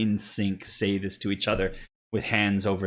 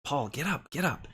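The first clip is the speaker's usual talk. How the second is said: Cole; 5 semitones higher than usual. Acted. Boy prompts his playmate to act fierce, hushed coaxing. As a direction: coax a playmate, out of character. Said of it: "you hear him kind of say".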